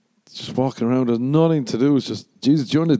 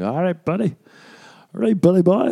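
mock cocky kid; 9 semitones higher than usual. Schoolboy answering the tough kids, higher cocky swagger. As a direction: play the protected kid, cocky. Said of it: "And I'm like".

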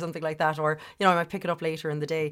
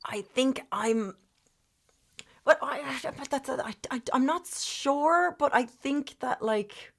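voice breaking; 9 semitones higher than usual. Real, not acted. Answering the host's question about pride, voice breaking with emotion. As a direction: fight to speak through rising tears. Emotional after bank fraud story.

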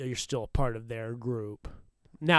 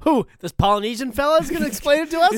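much higher, louder, fast mock outrage; mock crew member; 13 semitones higher, 10 dB louder, faster than usual. Host mocking the crew's reaction, much higher, louder and fast. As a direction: squeak it out as an indignant worker.

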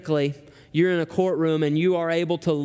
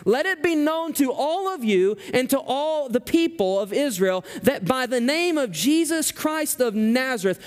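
bold, ringing proclamation, pitched much higher; fearless declaration; 5 semitones higher than usual. Shown as a demonstration. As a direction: proclaim it without a trace of fear. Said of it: "Peter has no fear whatsoever in declaring".

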